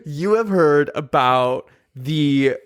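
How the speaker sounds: ordinary talk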